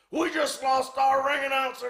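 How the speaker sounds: very high-pitched retold yell; exasperated shout. Promoter telling Butcher off over the announcer, exasperated shout. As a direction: yell it as an outraged complaint, high throughout.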